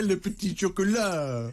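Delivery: high, drawn-out mock French accent; exaggerated French accent, teasing a co-host to copy it. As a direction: ham up the French, slow and high. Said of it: "said in your French accent".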